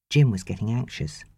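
ordinary delivery

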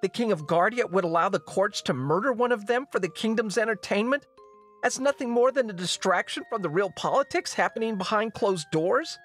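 high, strained, indignant tirade; impassioned outburst. Acted. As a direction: pour out your grievance at your father. Princess berating the king.

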